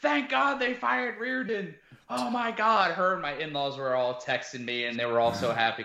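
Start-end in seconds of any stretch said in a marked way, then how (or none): 0.0-2.9 relieved wife. Retold or imitated. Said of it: "She's like".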